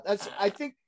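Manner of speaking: usual speaking voice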